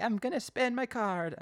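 high, loud, muddled put-on accent; Connie in unidentifiable accent. Host mimics Connie leaving to spend, in an unidentifiable accent. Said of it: "I don't know what accent that was".

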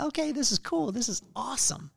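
pitched high with delight; like finding exactly what you need; excited. Retold or imitated. Reading a windshield repair sign, excited and pleased.

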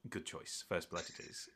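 low, very soft duelist; Fabian's reply. Acted. Duelist approving his opponent's choice, low and quiet. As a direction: answer low and under your breath. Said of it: "looks at you and goes".

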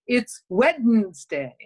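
"Wednesday" slowly, with its D sounded; Peter Mansbridge. Retold or imitated. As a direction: say "Wednesday" slowly as "Wed-nz-day". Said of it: "always remember Peter Mansbridge saying".

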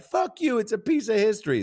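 high-pitched mock indignation; upset gal. Gal defends her fence to laughing strangers, mock upset. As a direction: imitate her offended retort, voice shooting up. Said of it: "now she's upset".

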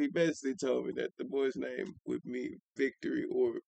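normal talking voice

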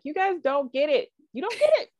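high, exasperated mock complaint; playing her upset self at the family; voicing her own frustration at the American family, high and exasperated. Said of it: "Very upset about it".

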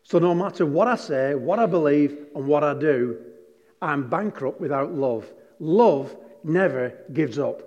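normal talking voice

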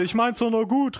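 lightly voiced high-pitched grandma; mock protest, called out high; grandma calling out. A narrator's reading. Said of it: "rief meine Oma". (Oma, leicht gespielt, hoch rufend)

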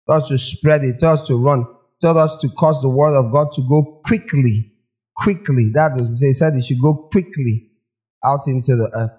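ordinary delivery

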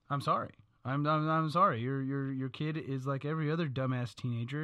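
normal talking voice

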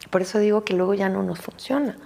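usual speaking voice

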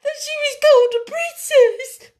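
high, loud sobbing voice; breaking into tears. A narrator's reading. Maid weeping for her poor young mistress, sobbing. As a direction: sob it out afresh. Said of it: "breaking out afresh".

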